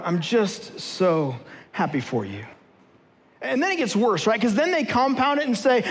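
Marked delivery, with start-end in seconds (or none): 0.0-2.4 low, slow and deflated; flat fake happiness. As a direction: say it through clenched resentment. Fake congratulations on boat.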